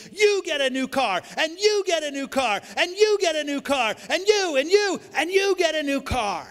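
high, loud giveaway shouts, laughing at the end; Oprah shouting. Retold or imitated. Preacher imitating Oprah giving away cars, shouting. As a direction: belt it out like Oprah handing out cars. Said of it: "she started shouting".

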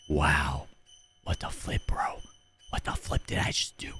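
stunned breathless whisper; shocked whisper. Real, not acted. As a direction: whisper in disbelief. Stunned by the ending.

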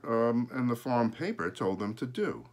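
usual speaking voice

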